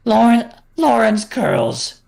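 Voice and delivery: pitch-shifted animatronic showman voice; voice-changed cartoon entertainer; Funtime Freddy voice. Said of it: "I'm practicing Funtime Freddy's voice".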